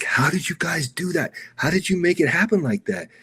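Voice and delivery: high, excited director voice; amazed director. Retold or imitated. Director asking the crew how they pulled it off, high and amazed. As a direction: imitate the stunned director's disbelief. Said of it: "the director's like".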